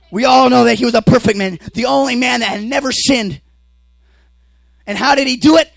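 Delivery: loud, high-pitched sermon shout; full-force preaching. Acted. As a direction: preach it at the top of your voice.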